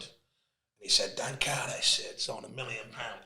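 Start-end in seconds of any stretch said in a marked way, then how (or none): 1.1-3.2 hushed attempt at an American accent. Imitating an American at Nike's box, soft American accent. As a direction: softly imitate an impressed American. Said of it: "I'm doing an American accent".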